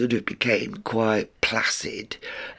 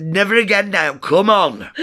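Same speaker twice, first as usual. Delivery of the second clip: laughing; 8 semitones higher, 5 dB louder than usual. Real, not acted. Laughing after ordeal.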